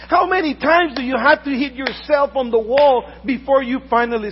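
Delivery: loud, fervent pulpit preaching; booming sermon voice; full-force preaching. Acted. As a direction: preach it out to the congregation with fire.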